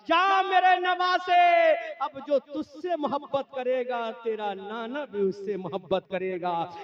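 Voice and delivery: high, loud, stretched proclamation; voice from the shrine. Acted. Imagined voice from the green dome answering, impassioned proclamation. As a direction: call out grandly, swelling to a drawn-out cry. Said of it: "गुंबद खजरा से आवाज आई होगी".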